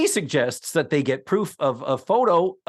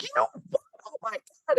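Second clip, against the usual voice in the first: much higher squeaky whine; mock falsetto exasperation; whiny falsetto; 4 dB quieter, much faster than usual. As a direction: squeal it way up high like an exasperated girl. Laverne voiced in retelling.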